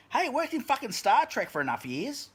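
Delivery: ordinary talk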